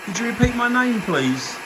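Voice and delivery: calling out. Real, not acted. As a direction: call out to an unseen spirit. Calling out to spirits.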